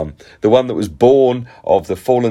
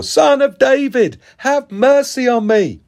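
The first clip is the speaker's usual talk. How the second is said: insistent shout; 3 dB louder than usual. A narrator's reading. Rebuked beggar to Jesus, shouting even more. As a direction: shout all the more, over those hushing you. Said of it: "he shouted all the more".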